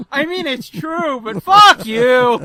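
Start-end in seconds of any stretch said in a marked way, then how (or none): 1.2-2.4 loud, drawn-out mock offence; playful cursing. Real, not acted. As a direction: throw it back loudly and slowly, amused. Playful retort to teasing.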